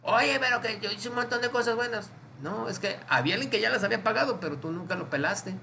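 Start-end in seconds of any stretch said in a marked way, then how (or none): 0.0-2.1 high, put-on pleading objection; high-pitched imitation of complaining; mock protest. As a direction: plead your case as a turned-away sinner. Acts sinner protesting at the gate.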